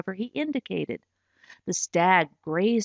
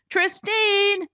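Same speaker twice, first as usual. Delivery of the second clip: higher, louder call from the pit; shouting; 10 semitones higher, 3 dB louder than usual. Acted. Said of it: "he shouted".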